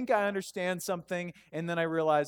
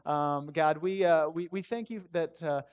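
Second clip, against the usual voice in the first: hushed, informal thanks to God; sincere prayer; 3 semitones lower than usual. Real, not acted. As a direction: lead the room gently in prayer. Leads congregation in prayer.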